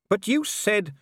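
high, urgent pleading; agitated. Acted. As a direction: press the point urgently. Man arguing with Death.

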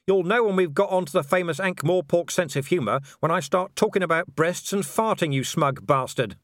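high, scornful yelled retort; angry shout. A narrator's reading. Vimes insults the smug werewolf, shouting back. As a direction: yell back, dropping onto the insult. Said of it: "Vimes shouted".

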